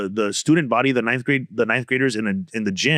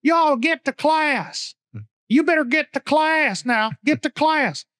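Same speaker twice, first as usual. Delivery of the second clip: much higher, slow drawn-out principal; principal impression; slower than usual. Principal ordering students to class, high slow mock voice. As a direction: stretch every word like a squeaky principal. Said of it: "his motto was".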